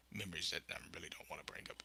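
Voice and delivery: hushed fast whisper; whispering a painful memory away. Real, not acted. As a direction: drop to a secretive whisper.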